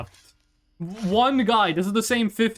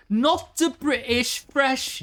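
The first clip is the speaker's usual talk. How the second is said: put-on slow, high posh British accent; 6 semitones higher, slower than usual. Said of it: "after I did that accent".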